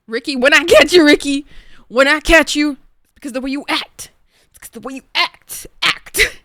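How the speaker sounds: high hammed-up threat, loud then easing; high-pitched mock outrage. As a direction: shout a playful warning at a rival. Repeats mock threat to Ricky.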